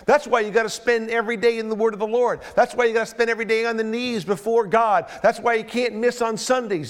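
loud, climbing preacher's list; preaching at full force. Acted.

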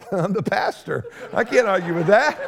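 normal talking voice

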